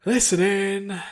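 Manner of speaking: loud, high called order; instructor's command. Acted. Instructor calling the next round, loud command. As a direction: summon the class for the next round. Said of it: "now her turn to attack".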